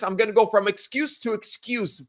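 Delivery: ordinary talk, nothing marked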